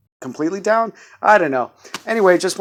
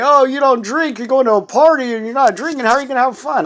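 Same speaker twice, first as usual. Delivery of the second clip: mock skeptic; 10 semitones higher, 6 dB louder, faster than usual. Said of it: "Some people might say".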